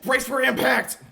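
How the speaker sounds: high, loud pilot yell into the comm; panicked shout. Acted. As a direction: scream the warning over the radio. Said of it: "goes into the comm saying".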